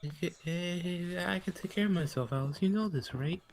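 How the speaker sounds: high, sheepish protest; awkwardly reassuring a worried partner; nervous reassurance. Acted. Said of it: "rubs back of his neck nervously".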